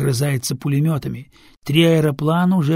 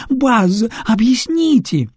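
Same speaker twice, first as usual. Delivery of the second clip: higher, louder urgent demand; anxious demand; 8 semitones higher, 5 dB louder, slower than usual. Acted. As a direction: demand an answer in fear. Frightened singer demands.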